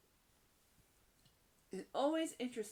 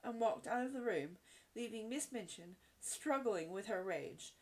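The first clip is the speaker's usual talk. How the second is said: ordinary delivery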